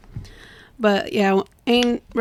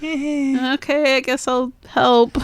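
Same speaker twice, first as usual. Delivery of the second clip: higher-pitched reluctant mock offer; awkward smile; 5 semitones higher, slower than usual. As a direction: agree awkwardly through a forced smile. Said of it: "he just smiles awkwardly".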